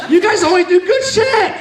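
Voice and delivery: comic shouting at the Rotary crowd, mock outrage; mock disbelief. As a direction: yelp it like you're scandalised. Mock outrage at charity club.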